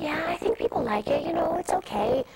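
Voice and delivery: a hint of a casual mom reassuring her; voicing her mother. Mother on the phone, voiced.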